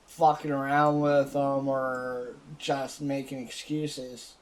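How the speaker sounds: ordinary talk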